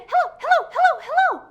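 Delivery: high, loud, rapid laughing hellos; fast and loud hellos. Shown as a demonstration. Librarian greeting kids in song, fast excited hellos. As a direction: fire off the hellos with glee. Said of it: "as fast as we can".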